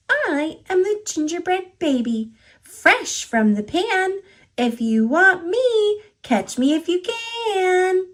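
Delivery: high, bouncy little cookie voice; playful high gingerbread baby taunt; cheeky gingerbread baby. A narrator's reading. Gingerbread baby's rhyme.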